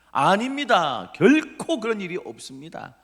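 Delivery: loud, high, emphatic denial; overconfident protest. Acted. Peter assuring Jesus he will never deny him, overconfident. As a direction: stretch the first word, insist with total certainty. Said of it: "자신만만했습니다".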